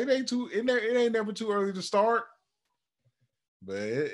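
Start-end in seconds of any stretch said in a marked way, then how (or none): 0.0-2.2 much higher, joking insistence; mock insisting. Dad defends training his newborn, high mock insistence. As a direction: insist playfully, pitched up. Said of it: "I was like".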